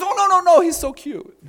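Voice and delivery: put-on high, loud gushing over the toddler; doting grandpa. Grandpa excusing his great-grandson on the cement, high doting gush. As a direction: melt over the little one. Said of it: "my grandpa goes".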